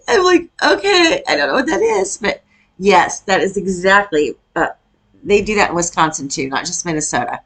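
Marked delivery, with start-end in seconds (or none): 0.1-2.2 high, laughing quote of her own reply; laughing through words. Real, not acted. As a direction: laugh while you say it. Laughing while quoting herself.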